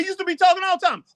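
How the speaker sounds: very high and rushed; hurried falsetto tease; high fast mock. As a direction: squeak it out quickly.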